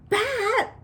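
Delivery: high, sharp scolding call; loud and sharp. A narrator's reading. Janie scolding her brother, loud and sharp. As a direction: call the name sharply, pitched well up. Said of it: "said Janie loud and sharp".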